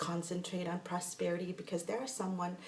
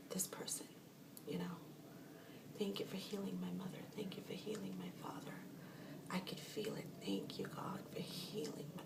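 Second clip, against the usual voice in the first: soft whispered thanks to God; whispered healing prayer; 7 dB quieter than usual. Shown as a demonstration. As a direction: breathe the prayer in a hush.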